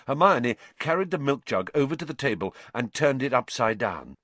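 ordinary delivery, nothing marked

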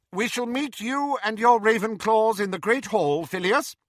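high, crisp commanding teacher; stern McGonagall. A narrator's reading. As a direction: speak like a strict headmistress.

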